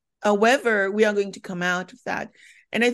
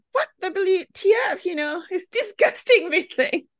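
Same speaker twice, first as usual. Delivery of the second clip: disgusted; 11 semitones higher than usual. Retold or imitated. Retelling first cigarette disgust.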